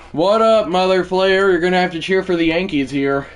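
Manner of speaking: loud, high-pitched greeting; hyped shout-out. Real, not acted. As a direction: holler hello to a friend in the chat. Greeting a viewer joining the chat.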